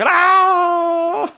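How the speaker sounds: high, loud startled yelp; a sudden high shriek of panic; mock panic shriek; panicked cry. Acting frozen panic.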